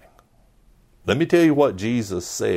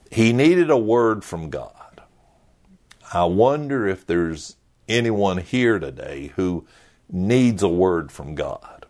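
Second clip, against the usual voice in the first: ordinary delivery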